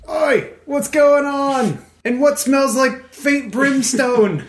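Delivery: high, slow ogre call from afar. Acted. Ogre in the distance wonders about the brimstone smell, high and slow. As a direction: call out puzzled like a dim brute.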